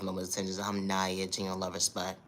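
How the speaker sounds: normal talking voice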